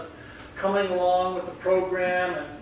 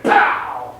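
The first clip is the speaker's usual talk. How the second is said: imitated strike; 7 semitones lower, 7 dB louder than usual. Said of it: "and then you went".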